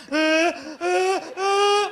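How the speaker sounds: high, loud strangled sneeze gasps; fighting back a sneeze, loud; held-in sneeze gasps. Retold or imitated. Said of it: "You, you got those friends".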